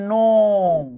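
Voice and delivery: "নোম" held long on the o; long, sustained "নোম"; drawn-out first syllable. Shown as a demonstration. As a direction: stretch "নোম" out as the first sound of a word. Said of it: "আপনি যখন নদী বানানটা উচ্চারণ করতে যান".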